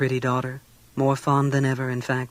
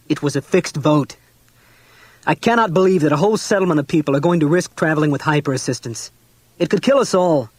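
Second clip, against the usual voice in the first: enraged; 6 dB louder than usual. A narrator's reading.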